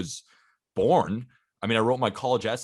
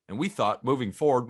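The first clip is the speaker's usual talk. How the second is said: normal talking voice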